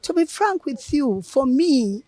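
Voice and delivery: normal talking voice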